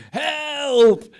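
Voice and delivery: loud, high, drawn-out yell; full-voiced imitation of a yell for help; yelled cry for help. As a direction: holler it long and high, as if across a battlefield. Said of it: "He steps up to the mic and yells".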